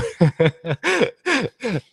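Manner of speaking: high bursting laughter; real laugh. Real, not acted. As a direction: break into a high giggle. Laughing at own joke.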